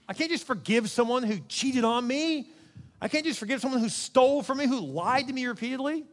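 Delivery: acted protest, pitched high; indignant objection. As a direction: protest in mock disbelief.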